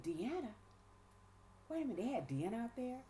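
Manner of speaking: high, quick, disbelieving double-check; astonished questioning. Retold or imitated. Woman learning Deanna is on the board, astonished. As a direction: replay being caught off guard, pitch jumping. Retells surprise at friend's name included.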